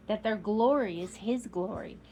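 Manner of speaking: plain reading voice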